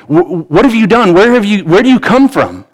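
high-pitched, stumbling alarmed questioning; alarmed Pilate. Acted. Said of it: "he's afraid".

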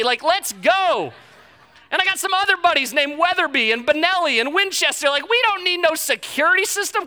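loud, high indignant rant; mock-offended bluster at the wife; offended husband. Husband refusing his wife's security system, loudly indignant. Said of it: "I kinda started to get offended".

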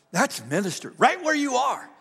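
"That's ministry" climbing high and loud into "right where you are"; ringing proclamation. Shown as a demonstration. Pastor proclaiming "That's ministry" to the congregation, high and loud. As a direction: lift "That's ministry" up into a shout. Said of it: "Ladies and gentlemen".

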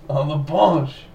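slow, laughing French "bench" as "bahnch"; playful French accent; mock French accent. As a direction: chuckle through a French "bench". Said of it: "in a French accent".

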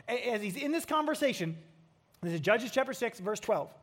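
ordinary delivery

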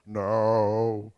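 low, sustained choral note; bass part. Shown as a demonstration. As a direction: drop it down like a bass singer. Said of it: "The bass".

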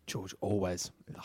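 whispered, soft and breathy; hushed whisper. Shown as a demonstration. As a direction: whisper the name like a secret.

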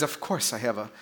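put-on low, soft British accent, a touch annoyed; badly faked British with understated irritation; annoyed mock British. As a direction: play a miffed British Rolls driver, quietly. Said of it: "starting to get annoyed".

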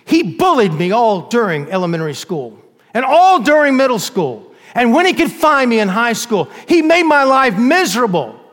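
put-on a teenager pours out years of hurt to the judge, rising and loud; rising accusation. Witness accuses the bully in court.

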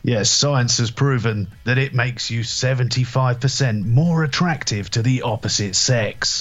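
slow, measured advert claim; mock science bit. Backing up the spoof ad, mock-scientific announcer read. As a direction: deliver it like an ad's expert.

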